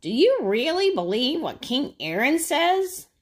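high, loud sly bird voice; a sneaky jay planting doubt; high, sly Blue Jay; tempting Blue Jay. Acted. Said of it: "the Blue Jay said".

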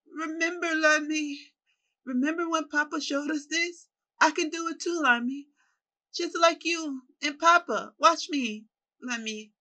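put-on high, loud, eager little-boy voice; excited child calling to his brother; childlike. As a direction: gush like an excited child reunited with family. Child voice of Claire again.

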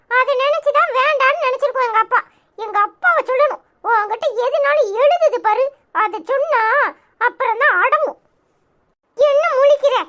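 ordinary delivery

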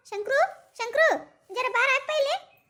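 high, drawn-out summoning call. Acted. Mother summons Shankru to come here, calling out. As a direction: call him over loudly, stretching the words.

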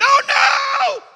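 very high, loud wail; hammed-up drawn-out cry of dread; mock dismay. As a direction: wail it high in pretend horror. Acting dismay at the trumpet.

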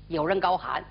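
ordinary delivery, nothing marked

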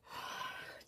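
long breathy sigh; exasperated sigh. Real, not acted. Vlogger fed up with a late upload, exasperated sigh. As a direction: sigh out your frustration.

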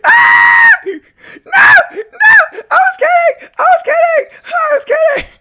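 high, loud panicked shrieks; terrified screaming. Real, not acted. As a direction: shriek and beg in terror. Caught by killer, screaming.

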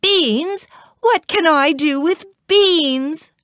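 high-pitched, indignant mother; very angry. A narrator's reading. Mother scolds Jack over the beans, angry. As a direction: exclaim in storybook outrage. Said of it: "She was very angry".

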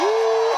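long, very high shouted "ooh"; high whoop. Real, not acted. Preacher stirred up before the congregation, high whoop. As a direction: let out a loud preacher's whoop.

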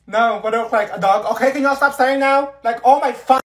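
high, loud fed-up yell; loud exasperated. Acted. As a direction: yell at them to stop staring. Yelling at staring classmates.

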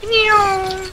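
high loud mock meow; cat meow. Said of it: "I was like".

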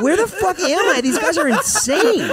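high, loud bewildered yell; hammed-up appalled outburst; mock disbelief. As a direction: cry out in exaggerated bewilderment, stretching the end. Acts bewildered bystander among them.

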